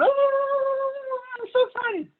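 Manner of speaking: very high, squealing mock delight; ecstatic cone. Lecturer voicing the blue cone, squealing with delight. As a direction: squeal it like a thrilled fan.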